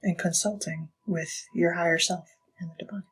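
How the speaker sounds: soft unvoiced whisper; hushed spiritual whisper. Real, not acted. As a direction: breathe it out reverently. Spiritual advice, voice drops.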